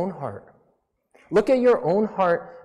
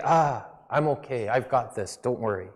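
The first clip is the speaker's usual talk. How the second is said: lower, softer, casually shrugging it off; mock carefree voice, quieter and lower; dismissive brush-off; 5 semitones lower, 5 dB quieter than usual. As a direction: wave off help like you don't need it. Israelites brushing God off.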